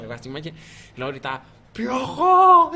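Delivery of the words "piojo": high laughing mock yell; mimicked fan bellow; imitated fan shout. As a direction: call out across the stadium. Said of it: "un señor que tenía la voz bien gruesa".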